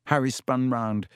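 plain reading voice

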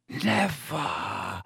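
dry, slow spider croak. A narrator's reading. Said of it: "croaked the old spider".

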